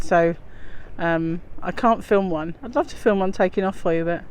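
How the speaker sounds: ordinary delivery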